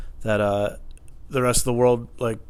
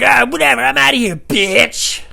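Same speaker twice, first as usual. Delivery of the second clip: loud, raised mock exit line; dismissive parting yell; 13 semitones higher, 10 dB louder, slower than usual. Customer leaving the fight, dismissive angry yell. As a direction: imitate a man giving up and yelling.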